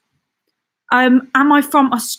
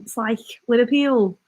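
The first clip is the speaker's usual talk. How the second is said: playful Liverpool accent; Scouse accent. Shown as a demonstration. Streamer demonstrating Liverpool speech to viewers, Scouse accent. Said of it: "that's more of a Scouse vibe".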